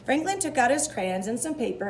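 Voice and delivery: plain reading voice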